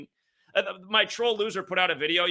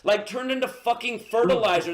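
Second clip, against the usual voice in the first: angry rant; 6 semitones higher, 3 dB louder than usual. Real, not acted. Raging at caller.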